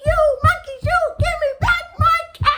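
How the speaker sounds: very high, very loud yell; really very angry. Acted. Furious peddler jumps and yells at the monkeys, very high. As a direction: jump and yell for the caps. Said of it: "the peddler was really very angry".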